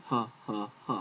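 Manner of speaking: "H, H, H" as breathed "hah" syllables; H sound. Shown as a demonstration. Teacher demonstrating the letter Hey, "H" breathed out as "hah". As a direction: sound out "H" as a separate "hah" each time. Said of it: "H sound".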